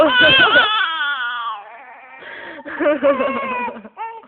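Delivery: loud, high laughter almost a shriek; loud laughing fit. Real, not acted. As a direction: howl with laughter. (malakas na tawa)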